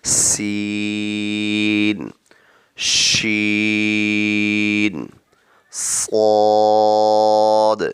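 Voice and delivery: سین slowly with a long sharp hiss; whistled seen. Shown as a demonstration. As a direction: stretch the س into a whistle. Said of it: "تیز آواز سے پڑھا جائے گا".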